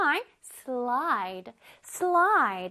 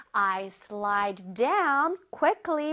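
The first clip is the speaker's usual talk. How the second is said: slow read; 4 semitones lower, much slower than usual. Shown as a demonstration. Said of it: "teacher reading example".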